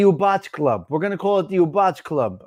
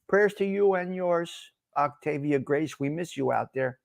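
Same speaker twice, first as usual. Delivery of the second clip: usual speaking voice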